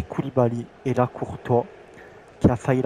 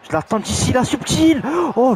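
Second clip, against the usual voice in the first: excited; 12 semitones higher, 6 dB louder than usual. Real, not acted. Anticipating a chip shot.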